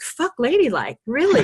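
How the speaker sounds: defiant retort. Retold or imitated. Healer scoffing at clients' ladylike worries, defiant. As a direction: brush off the prudish objection. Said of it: "And I'm like".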